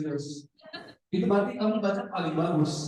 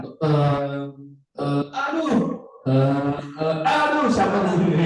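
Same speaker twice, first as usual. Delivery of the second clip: hesitant "eh" with flustered outbursts; stumbling reader; 3 semitones lower, 3 dB louder, slower than usual. Shown as a demonstration. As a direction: act out a reader losing the thread. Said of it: "Lancar, nggak ada kesalahan".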